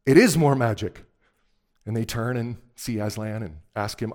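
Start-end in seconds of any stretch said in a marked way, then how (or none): 0.1-1.0 loud, emphatic proclamation; great voice. A narrator's reading. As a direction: announce it as a mighty voice. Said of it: "said a great voice behind them".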